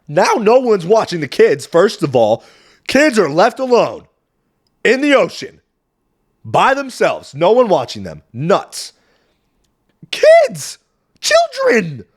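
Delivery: high, loud, incredulous rant; outraged disbelief. Real, not acted. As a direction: pitch it up in indignant disbelief. Outraged that kids are left alone.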